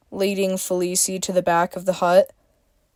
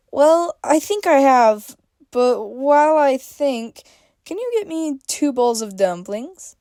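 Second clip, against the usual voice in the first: beaming Freya; 6 semitones higher than usual. A narrator's reading. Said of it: "she beamed".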